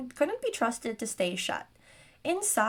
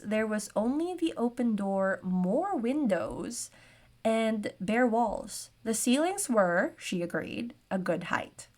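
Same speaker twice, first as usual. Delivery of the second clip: normal talking voice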